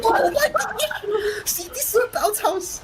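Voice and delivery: high, cracking shriek with a laugh; real excited scream. Real, not acted. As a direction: lose it completely at the play. Wild play, caster erupts.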